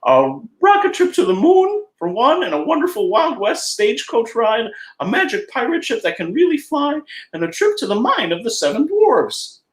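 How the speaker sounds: squeaky friendly cartoon mouse; Mickey Mouse falsetto. Mickey describes Disneyland.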